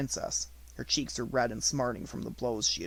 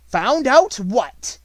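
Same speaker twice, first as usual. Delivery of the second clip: very high, loud, slow outburst; alarmed exclamation; 11 semitones higher, 13 dB louder, much slower than usual. A narrator's reading. Headmistress shrieking at a pupil's daring claim. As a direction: shriek it, shaken and angry. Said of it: "she exclaimed".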